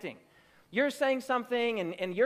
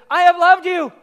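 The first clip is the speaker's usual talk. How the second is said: much higher and far louder; ringing declaration; 7 semitones higher, 15 dB louder than usual. Retold or imitated. Preacher voicing God to the church, loud ringing proclamation. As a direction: proclaim it with full force. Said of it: "God opens with".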